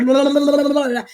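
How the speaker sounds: mock high, loud rapid gabbling; anxious fast babble. Anxious candidate in an interview, mock babbling very fast. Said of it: "I speak very fast when I get anxious".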